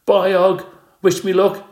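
high, loud squeak; a small hamster squeaking goodbye; squeaky hamster. A narrator's reading. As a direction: squeak it out to a friend. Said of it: "I squeaked to my friend".

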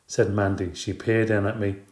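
plain reading voice

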